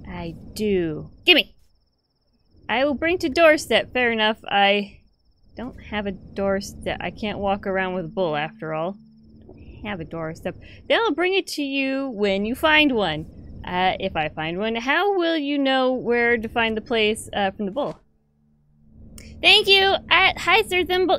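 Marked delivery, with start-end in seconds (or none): 1.2-1.5 higher, louder grabby demand; snatch it like a greedy child; eager demand. Pretend. Gamer demanding the bull from the seller, loud and eager. 19.4-21.2 much higher, louder greeting; delighted squeal. Real, not acted. Gamer greeting his new bull, high delighted squeal.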